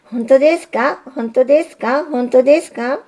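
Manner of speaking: can't believe his luck, asks thrice; excited suitor pressing again and again; eager disbelief. Acted. Signal's eager repeated question.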